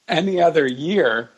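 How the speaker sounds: ordinary delivery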